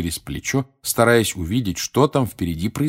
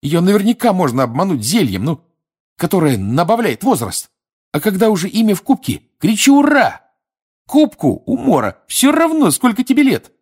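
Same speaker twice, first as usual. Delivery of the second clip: high, loud, fired-up scheming; worked-up boy crowing at full pitch; indignant excitement; 11 semitones higher, 6 dB louder than usual. A narrator's reading. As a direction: burst out loudly, pitch climbing to a cheer. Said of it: "Глаза у него возмущенно сверкали".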